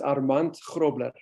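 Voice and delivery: "Armand Grobler" said the South African way; South African pronunciation. Shown as a demonstration. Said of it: "there is a South African pronunciation".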